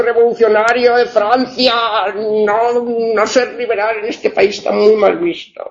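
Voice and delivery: high-pitched mock-scandalised voice; mock disapproval. Jokey scandalised reading, then says joking.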